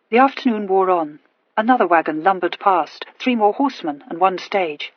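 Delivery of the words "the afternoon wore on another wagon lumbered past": usual speaking voice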